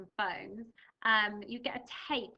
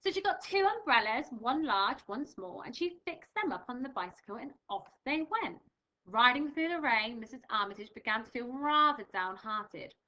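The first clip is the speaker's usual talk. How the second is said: louder, animated storybook reading; reading to children; 5 dB louder than usual. Acted. Teacher reading Missus Armitage to pupils, animated read-aloud. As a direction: bring the story alive for young listeners.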